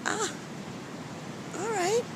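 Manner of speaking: high, soft pleasantly surprised all right; pleased surprise. Retold or imitated. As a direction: catch yourself in the mirror and like it. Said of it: "I said".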